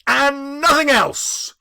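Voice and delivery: loud, high and slow triumph; triumphant. A narrator's reading. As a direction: savour the win, slowly. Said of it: "said Scrooge triumphantly".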